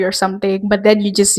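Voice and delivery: ordinary talk, nothing marked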